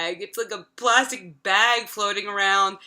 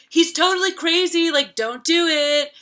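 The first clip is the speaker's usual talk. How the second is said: alarmed warning; 9 semitones higher, 7 dB louder than usual. Retold or imitated. Friend warns her off the boy.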